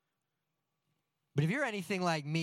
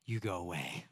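much lower, softer, deadpan order; blunt toddler voice; 10 semitones lower, 5 dB quieter than usual. Retold or imitated. Pastor mimics daughter telling him to go away, low and flat. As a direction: dismiss him flatly like a toddler. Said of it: "She goes".

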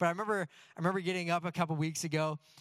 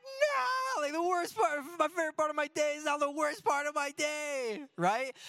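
much higher, hammed-up crying voice; mock heartbroken wail; 11 semitones higher than usual. Pastor hams up his crushed reaction to daughter, much higher. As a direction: wail like a heartbroken dad, half joking. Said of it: "I'm like".

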